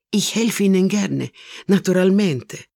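Italian mama thrilled to be asked; eager offer. Acted. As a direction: jump at the chance to help. Carlotta eagerly offers help.

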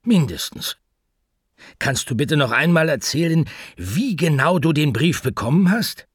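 anxious pet shop owner talking to the answering machine; Mortimer the pet shop owner, nervous. Acted. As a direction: rush the message, worried and flustered.

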